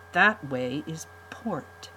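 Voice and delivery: slow, deliberate correction; slow sullen correction. Acted. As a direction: spell it out to the Count.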